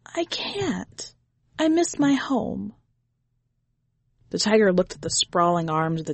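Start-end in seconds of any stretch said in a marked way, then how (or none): 0.1-2.7 put-on high little boy voice; child. A narrator's reading. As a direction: sad small child refusing.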